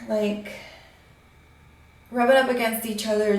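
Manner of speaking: normal talking voice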